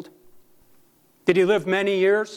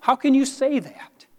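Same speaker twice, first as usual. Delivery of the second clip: high-pitched quoted challenge; challenging questioner; 8 semitones higher than usual. Retold or imitated. Preacher quotes someone challenging his words, high questioning voice. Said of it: "Someone once asked me".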